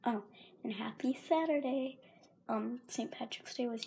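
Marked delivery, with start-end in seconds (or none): none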